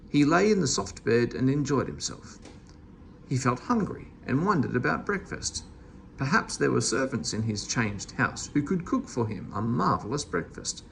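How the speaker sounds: usual speaking voice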